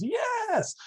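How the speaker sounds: high, held mock-grandpa yes; grandpa's emphatic yes. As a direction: mimic an old man insisting on his point. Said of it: "That's kinda how my grandpa says words".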